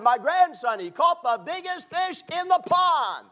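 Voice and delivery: imitating a proud, boastful grandpa, high and loud; bragging grandpa. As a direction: show off your grandson to the neighbors. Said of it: "he was so braggadocious".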